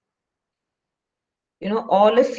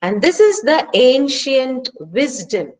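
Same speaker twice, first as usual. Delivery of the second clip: ordinary talk, nothing marked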